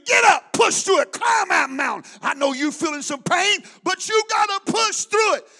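preacher mimicking his coach shouting; imitating coach screaming. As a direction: push him like a coach shouting. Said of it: "He's screaming".

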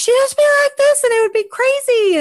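mock high, fast, loud voice. Mocking an actress's crazy delivery, high-pitched. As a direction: imitate her in an over-the-top high squeal. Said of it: "her voice was a little higher".

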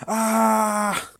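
high drawn-out "ah"; mock thinking cry. Pretend loud thinking.

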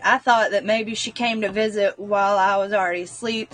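normal talking voice

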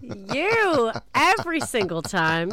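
usual speaking voice

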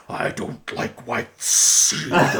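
slow, drawn-out tree creature voice; hammed-up ponderous creature, swelling as it goes; Treebeard. Tree creature answering two beige hobbits, slow and ponderous. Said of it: "he's just like".

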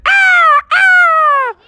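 high, loud bird cry; eagle screech. Acted. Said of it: "Eddie the Eagle swooped down and said".

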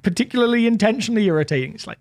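ordinary talk, nothing marked

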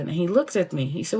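ordinary talk, nothing marked

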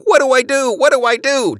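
very high, loud panicky mimicry; mock the flustered officer; spy imitating the officer's panic; panicked officer mimic. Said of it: "Iverson is only thinking".

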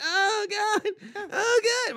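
put-on high, loud cramping kid; mock wailing. Kid groaning from pork cramps, mock wailing. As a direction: wail it like a child in agony.

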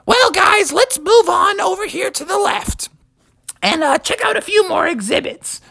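Mother Bear cheerfully steering the family onward. Acted. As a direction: lead the family on like a chirpy mother. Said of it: "Then Mother Bear said".

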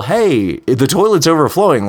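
mock yell. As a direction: imitate a plea yelled under a door, without full volume. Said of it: "trying to scream underneath the door to yell".

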